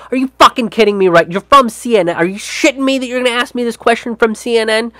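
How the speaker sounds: exasperated mock outrage, rushed at the end; incredulous anger. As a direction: snap at the CNN reporter in disbelief. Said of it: "The tone of it is just like".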